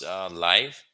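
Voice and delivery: normal talking voice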